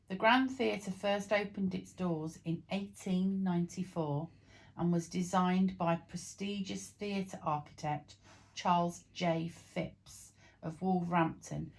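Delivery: ordinary talk, nothing marked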